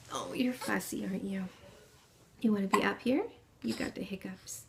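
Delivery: quiet murmur, trailing into a whisper. Real, not acted. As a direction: drop to a hushed, soothing voice. Turns to her fussy baby.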